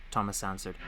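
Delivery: ordinary delivery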